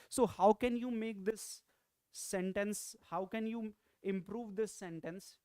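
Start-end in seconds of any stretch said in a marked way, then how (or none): none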